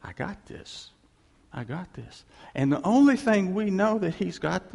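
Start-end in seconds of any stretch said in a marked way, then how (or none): 0.0-2.2 whispered, reassuring I got this; God whispering I've got this to a worried believer. Retold or imitated. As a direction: breathe it like a calm secret promise. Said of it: "And he says".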